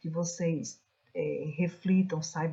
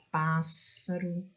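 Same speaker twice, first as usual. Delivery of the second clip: "pássaro" slowly, first syllable PÁS drawn out; stressed antepenult; much slower than usual. Shown as a demonstration. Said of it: "a posição da sílaba tônica".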